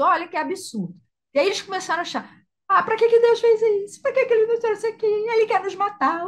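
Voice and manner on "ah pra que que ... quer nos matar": high, loud mock grumbling; hammed-up grumbling crowd; whiny complaining Israelites. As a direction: play the sulky people accusing God. The people complaining against God.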